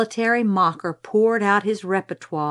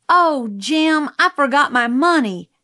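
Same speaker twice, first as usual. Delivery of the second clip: higher, louder acted lament; dismayed sigh; 6 semitones higher, 5 dB louder than usual. A narrator's reading. Scout to Jim on seeing the booths, dismayed sigh. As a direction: let dismay lift the voice. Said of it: "I sighed when I saw them".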